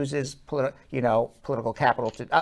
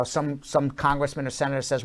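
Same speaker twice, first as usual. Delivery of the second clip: usual speaking voice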